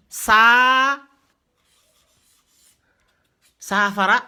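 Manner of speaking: "saafara" with a sustained opening aa; drawn-out vowel. Shown as a demonstration. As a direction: stretch the first a of "saafara". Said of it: "ici, c'est tiré".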